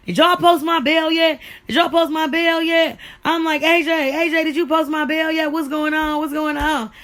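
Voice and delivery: loud, high, urgent repeated questions; frantic calling. Retold or imitated. Calling A J from jail to ask about bail, frantic. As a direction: demand answers, panicked and impatient.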